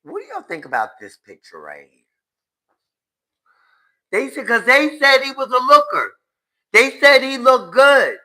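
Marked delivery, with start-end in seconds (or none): 4.1-8.2 loud, high mocking retell; mock exclaim. Vlogger mocking what people said about him, loud and high.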